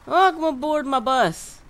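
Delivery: hoch, laut und langsam, wie ein Busfahrer; begrüß die Fahrgäste feierlich gedehnt; Durchsage des Busfahrers. Pretend. Greeting bus passengers as driver.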